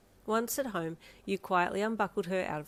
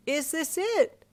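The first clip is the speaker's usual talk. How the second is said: friendly patron; 10 semitones higher, 6 dB louder, much slower than usual. A narrator's reading.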